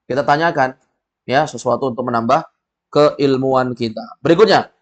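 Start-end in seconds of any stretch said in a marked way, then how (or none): none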